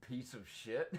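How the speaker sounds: ordinary talk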